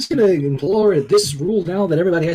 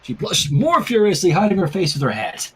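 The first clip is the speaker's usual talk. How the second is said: normal talking voice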